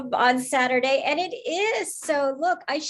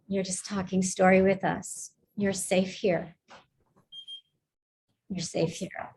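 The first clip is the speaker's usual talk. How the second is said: soothing reassurance; 4 semitones lower than usual. Real, not acted. Host tells nervous student they are safe, soothingly. Settling the nervous speaker after breathing.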